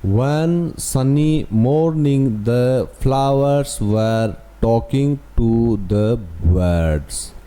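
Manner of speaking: very slow, word by word; slow read-aloud. Shown as a demonstration. Teacher reading the story line to students, very slow. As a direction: read the sentence out slowly. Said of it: "अब देखो हम यहाँ पढ़ते हैं".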